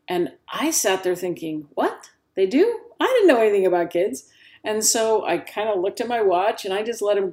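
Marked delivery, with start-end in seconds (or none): 1.7-4.3 high-pitched, incredulous questions, then quick admission; acted astonishment. New mom learning kids cry before sleep, mock astonished. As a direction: react like it's complete news to you.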